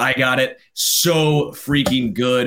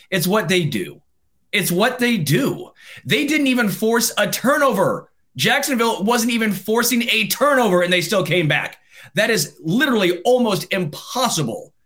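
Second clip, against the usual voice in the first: worked-up, high-pitched disbelief; higher, emphatic sports-fan outrage; exasperated rant; 7 semitones higher than usual. Real, not acted. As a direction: rant in rising incredulity. Worked-up rant about a rival's collapse.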